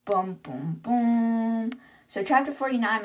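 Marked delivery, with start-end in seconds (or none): 0.0-1.7 mock-ominous "dun dun dun" style booms; drawn-out booms, the last rising in pitch; dramatic boom sting. Reader teases the cliffhanger at chapter's end, mock-ominous booms.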